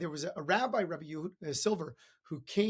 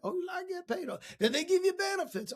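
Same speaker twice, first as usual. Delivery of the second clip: high-pitched put-on accent; hammed-up folksy questioning; lousy Zig Ziglar; 14 semitones higher than usual. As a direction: do a bad impression of a motivational speaker. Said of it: "That was a lousy impersonation of Zig Ziglar".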